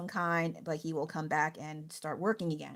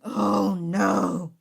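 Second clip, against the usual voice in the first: louder, heartfelt cry of dismay; the bad news lands hard; retold dismay at his death; 10 dB louder than usual. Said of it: "So I was like".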